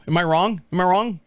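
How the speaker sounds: ordinary delivery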